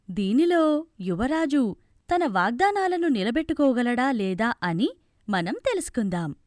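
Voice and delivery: ordinary delivery, nothing marked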